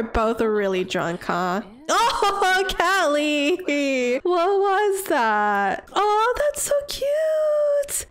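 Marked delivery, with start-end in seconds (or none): 1.9-8.1 much higher, squealing with surprise; delighted squeal. Real, not acted. Streamer seeing the couple's cute interaction, squealing much higher. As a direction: gush over something adorable.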